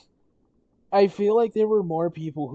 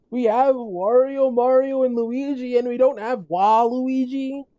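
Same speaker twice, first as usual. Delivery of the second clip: loud, much higher, incredulous outburst; mad rant; 9 semitones higher, 3 dB louder than usual. Real, not acted. Streamer ranting to chat that Waluigi was left out, mad. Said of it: "I'm not still mad".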